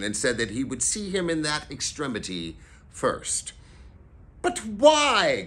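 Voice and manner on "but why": high, loud, baffled appeal; crying out. A narrator's reading. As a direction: cry it out as a protest. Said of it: "cried Scrooge's nephew".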